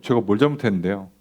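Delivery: low, sulky "제가" as a pushback; defensive retort. Retold or imitated. Israelites talking back to God, sullenly defensive. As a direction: mutter back defensively, 제가 leaned on. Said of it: "우리는 뭐라고 한다고요?".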